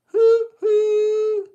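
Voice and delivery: high, loud hoo hoo whistle; train whistle. Shown as a demonstration. Showing kids how Thomas whistles, loud high hoot. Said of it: "Instead of hoo, hoo".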